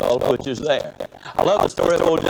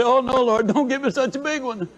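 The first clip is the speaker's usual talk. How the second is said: higher, pleading mock whine to the Lord; whiny plea; 11 semitones higher than usual. Speaker mocks people begging God for small goals, high whine. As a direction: beg like someone scared of a big goal. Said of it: "we say".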